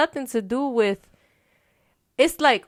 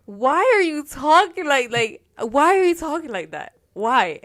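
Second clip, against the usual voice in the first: baffled questioning; 5 semitones higher, 3 dB louder than usual. Retold or imitated. Retells challenging his odd way of talking.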